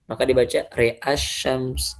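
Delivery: usual speaking voice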